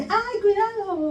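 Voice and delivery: high, drawn-out mock shout; mock warning yell. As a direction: imitate a high-pitched cry of alarm without real volume. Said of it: "estábamos gritándole".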